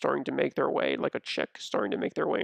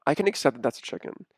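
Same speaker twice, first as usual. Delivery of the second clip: ordinary talk, nothing marked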